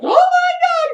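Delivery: high, loud shriek of panic; panicked cry. Retold or imitated. She learns of a mass in her head, panicked high cry. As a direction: scream it in alarm.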